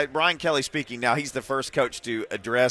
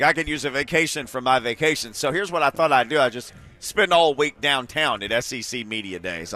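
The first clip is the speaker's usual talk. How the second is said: ordinary talk, nothing marked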